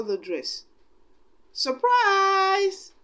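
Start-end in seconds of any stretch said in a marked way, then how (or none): 1.5-2.9 high, loud happy cry; spring the surprise on her; bright surprised shout from friends; cried out. A narrator's reading. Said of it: "her friends cried".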